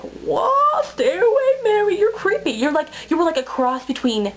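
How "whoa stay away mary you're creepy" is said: creeped-out reaction. Retold or imitated. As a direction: back away from someone creepy, pitched way up. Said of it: "I'm just like".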